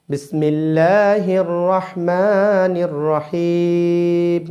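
slow, drawn-out chanted recitation; Quranic recitation. Shown as a demonstration. Teacher opening the Quran lesson with slow recitation. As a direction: intone it like tajweed recitation.

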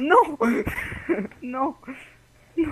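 high shocked cry. Real, not acted. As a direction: gasp it out in dismay. Killed his friend by accident.

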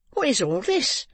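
put-on high, startled young woman's question; involuntary cry. A narrator's reading. Girl startled by the old man's threats, involuntary cry. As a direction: blurt it out high. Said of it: "cried the girl involuntarily".

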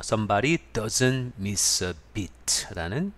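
stretched out so learners catch it; slow model phrase. Shown as a demonstration. Teacher models the idiom for Korean learners, very slowly. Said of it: "teacher models the phrase".

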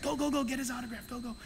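quick, soft urging; eager parents. Retold or imitated. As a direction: hurry the kids along. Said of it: "the parents are like".

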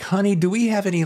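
high-pitched, eager "honey" call; lively high retelling; excited retold question. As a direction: act out asking your partner excitedly.

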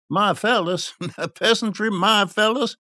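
high, incredulous exclamation; crying out. A narrator's reading. Merchant rejecting kinship with the peasantry, crying out. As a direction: repeat it back in scornful disbelief. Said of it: "cried the other".

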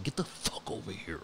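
hammed-up growling order; pit bull growl. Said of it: "big bully pits, and they're just like".

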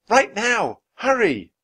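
high, loud urgent yell. A narrator's reading. Annie warns the card players, yelling. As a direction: yell it across the room. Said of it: "Annie yelled at the card players".

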